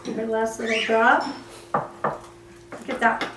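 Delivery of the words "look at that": whisper. Real, not acted. Whispered aside while cooking.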